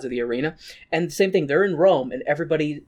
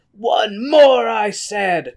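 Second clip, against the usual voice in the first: louder, higher demand; mock drunken cry; 5 semitones higher, 5 dB louder, much slower than usual. Drunk Raoul demands one more, loud mock cry. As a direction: cry out for another drink, hammed up. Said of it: "cries Raoul".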